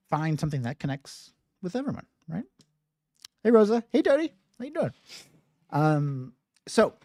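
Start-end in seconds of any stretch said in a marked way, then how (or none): 3.4-4.9 high-pitched hello to people arriving; pitched-up friendly shout-out; friendly greeting. Real, not acted. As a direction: greet newcomers in a raised, lilting voice. Calling out greetings to arriving viewers.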